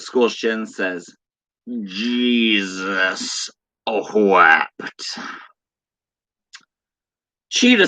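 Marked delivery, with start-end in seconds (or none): 1.8-5.2 very slow, drawn-out and solemn; slow dramatic delivery. Acted. Streamer reading a viewer's chat, very slow and solemn. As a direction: let each word hang.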